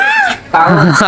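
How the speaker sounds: high-pitched burst of laughter. Real, not acted. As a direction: crack up briefly in a high voice. Laughing during a don't-laugh game. (ઊંચા સ્વરે હાસ્ય)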